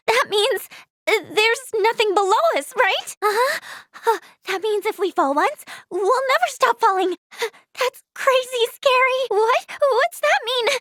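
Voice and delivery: high, anxious fretting; nervous alarm. Acted. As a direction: let the worry push your pitch up. Realizes nothing is below the ship.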